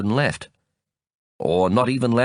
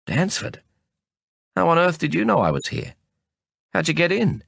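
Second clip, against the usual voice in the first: higher-pitched questions, astonished; surprised exclamation; 7 semitones higher than usual. A narrator's reading. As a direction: exclaim in surprise at an unexpected visitor. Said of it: "I exclaimed".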